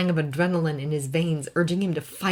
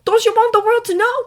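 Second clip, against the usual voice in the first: much higher, louder, fast push; pressing Clark; 10 dB louder, faster than usual. A narrator's reading. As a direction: urge him quickly.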